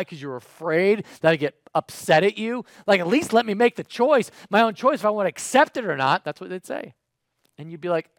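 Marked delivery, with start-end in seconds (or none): 0.0-6.2 indignant complaint. Retold or imitated. Friend angrily complaining after crash.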